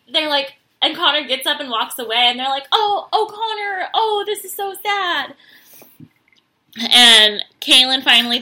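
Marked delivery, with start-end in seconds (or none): 2.7-5.3 mock pity. Crowd pitying Connor.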